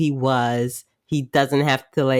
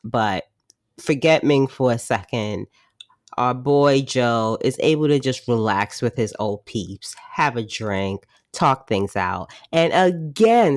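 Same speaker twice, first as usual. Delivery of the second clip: ordinary talk, nothing marked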